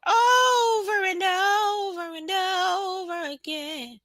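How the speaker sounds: high, drawn-out singer's whine. Retold or imitated. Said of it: "In my [name] voice".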